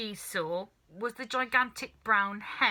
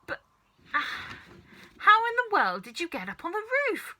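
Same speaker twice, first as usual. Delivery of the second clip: much higher, astonished cry; bewildered cry; 11 semitones higher, 4 dB louder than usual. A narrator's reading. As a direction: stammer then cry out in disbelief. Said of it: "cried the mother".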